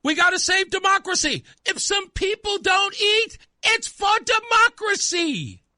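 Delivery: high, shouted mock politician rallying cry; a hammed-up shout to sell a bad idea; mocking Chris Murphy. Host mocking senator's call for sacrifice, hammed-up high shout. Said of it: "That's what Chris Murphy's telling us".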